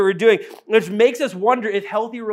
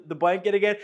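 ordinary talk, nothing marked

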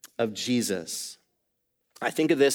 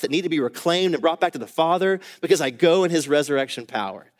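louder and higher, driving preacher voice; preaching at full force; 4 semitones higher, 4 dB louder than usual. Acted. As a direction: build it up and preach it hard.

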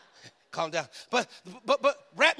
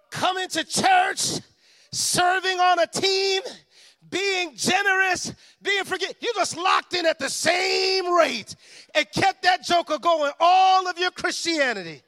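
preaching; 8 semitones higher, 8 dB louder than usual. Acted. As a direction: preach it with full force. Preaching build.

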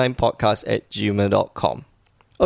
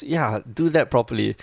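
ordinary delivery

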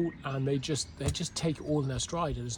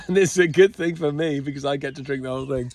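gleeful quote through laughter; chuckling as you quote yourself; laughing; 8 dB louder than usual. Retold or imitated. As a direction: laugh while gloating. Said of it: "I was like".

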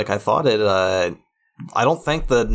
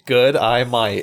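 mock-Australian demonstration; deliberate put-on Australian vowels; Australian accent; slower than usual. Shown as a demonstration. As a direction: teach the Aussie greeting, word by word. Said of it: "Say it with me".

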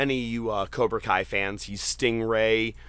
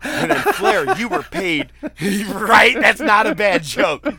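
laughing while retelling; 11 semitones higher, 8 dB louder than usual. Real, not acted. Cracking up at roast joke.

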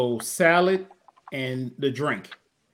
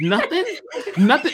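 high, laughing disbelief; incredulous laugh; 8 semitones higher, 3 dB louder than usual. Real, not acted. Disbelief at no sweet potato.

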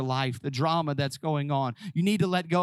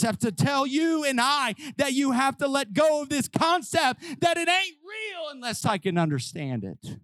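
preaching climax; 10 semitones higher than usual. Acted. Preacher urging congregation, high full-force preaching. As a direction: drive it home to the congregation.

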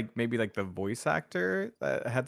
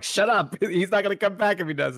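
snippy sidekick; 9 semitones higher, 5 dB louder than usual. Retold or imitated. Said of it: "They're like".

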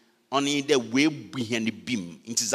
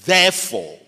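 "Therefore" set apart, louder and higher, falling; stressed therefore; 5 semitones higher, 6 dB louder than usual. Shown as a demonstration. Preacher opening the verse, loud emphatic "Therefore". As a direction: announce "Therefore" like a trumpet call. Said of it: "verse one begins with".

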